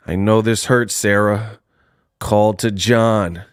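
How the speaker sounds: low, slow, deliberate menace; lightly voiced cold machine, low pitch; T-1000. Retelling the knife scene from Terminator 2, low slow imitation. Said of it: "He was like".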